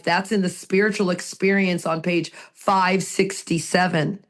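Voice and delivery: ordinary delivery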